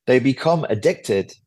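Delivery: usual speaking voice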